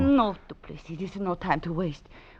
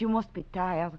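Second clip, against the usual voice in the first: ordinary delivery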